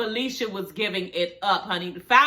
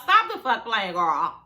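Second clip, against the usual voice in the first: ordinary talk, nothing marked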